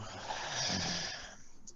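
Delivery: long tired sigh; weary sigh. Real, not acted. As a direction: sigh it out, fed up. Complaining about the satellite picture.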